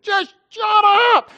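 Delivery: high, slow childish mock yell; kids mocking. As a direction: squeal it like a cheeky kindergartner. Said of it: "They said".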